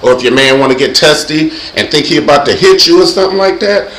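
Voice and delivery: ordinary talk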